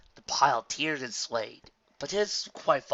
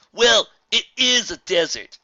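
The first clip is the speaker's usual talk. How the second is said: higher, much louder, drawn-out character voice; loud, blunt retort; 10 semitones higher, 13 dB louder, slower than usual. Acted. Traveller answering a complaint about the desert, loud and blunt. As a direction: call it out slowly, stating the obvious.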